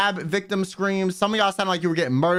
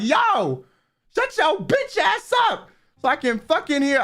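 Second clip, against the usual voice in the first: annoyed fan; 12 semitones higher, 3 dB louder, slower than usual. Retold or imitated. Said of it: "another Kill Me that was like".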